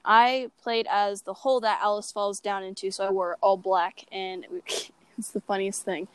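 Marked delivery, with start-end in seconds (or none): none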